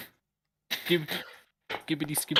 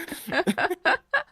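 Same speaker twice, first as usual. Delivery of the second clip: high laugh; 12 semitones higher than usual. Real, not acted. Player laughing at tea story.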